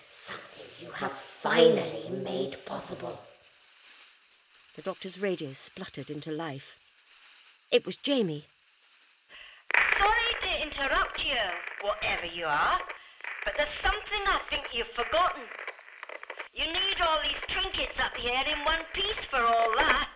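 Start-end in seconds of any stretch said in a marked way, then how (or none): 0.1-3.2 creeping nightmare whisper. Acted. Alien gloating. 10.0-20.1 Scottish lad. Acted. Jamie over the radio.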